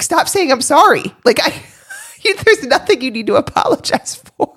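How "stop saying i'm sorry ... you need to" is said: exasperated outburst. Retold or imitated. Telling her apologetic husband to stop apologising, exasperated. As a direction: throw it back, half laughing, exasperated. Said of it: "I was like".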